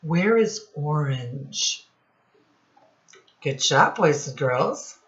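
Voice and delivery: normal talking voice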